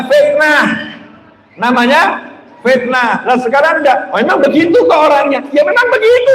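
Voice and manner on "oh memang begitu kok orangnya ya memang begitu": high-pitched mock protest; defensive retort. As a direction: imitate an indignant excuse, pitch leaping up. Voicing people who justify gossip.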